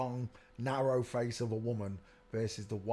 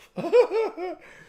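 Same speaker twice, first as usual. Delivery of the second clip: high laugh; 11 dB louder than usual. Real, not acted. Laughing at a photo.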